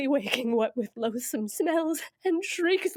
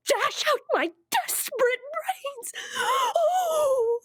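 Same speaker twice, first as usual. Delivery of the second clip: high crying wail at the peak of terror; desperate cry; 9 semitones higher, slower than usual. Acted. Juliet imagining madness in the tomb, crying out. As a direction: let the fear break into a cry.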